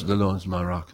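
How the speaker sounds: low, soft, detached reading; flat rote reading. Shown as a demonstration. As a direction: mumble it like a chore. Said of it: "there's difference?".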